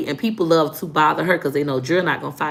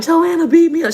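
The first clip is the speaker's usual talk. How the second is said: put-on whiny kid crying; 11 semitones higher, 7 dB louder than usual. Child crying to sister again.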